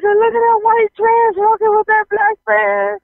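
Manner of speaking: very high gawking voice, retold; shocked exclamation. Newcomer points out a couple to her siblings, shocked. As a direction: act out scandalized astonishment. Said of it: "so shocked that they were just".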